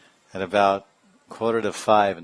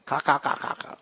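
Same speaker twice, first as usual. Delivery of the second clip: higher mock cawing fading out; rooster caws; 6 semitones higher than usual. Lecturer acts out the rooster waking the couple, mock cawing. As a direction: caw like a rooster, then let it fade. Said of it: "like that".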